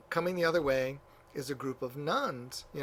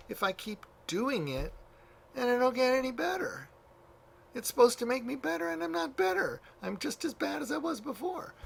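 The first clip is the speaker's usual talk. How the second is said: much higher, pleading complaint; whiny young monk; 11 semitones higher than usual. Retold or imitated. Young monk complains to the elder, high whining. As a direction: plead like a frustrated novice.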